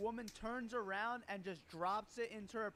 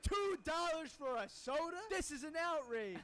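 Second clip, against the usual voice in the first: higher, slower, indignant customer voice; mock outrage; 6 semitones higher than usual. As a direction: ham up the customer's shock at the price. Customer outraged over soda price.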